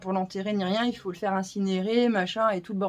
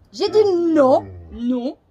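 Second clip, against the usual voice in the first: firm scolding; 4 semitones higher, 6 dB louder, much slower than usual. Real, not acted. Scolding the dog.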